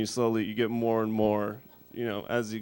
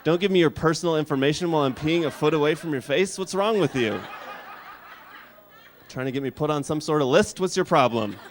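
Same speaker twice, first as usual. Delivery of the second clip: louder, higher exasperated rant; mock angry outburst; 5 semitones higher, 7 dB louder than usual. Comic yelling at the kid beside him at the urinal, mock-angry rant. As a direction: blow up at the kid in disbelief.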